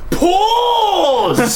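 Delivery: booming high wizard shout; Gandalf command. Pretend. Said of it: "like Gandalf".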